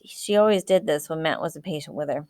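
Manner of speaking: ordinary delivery, nothing marked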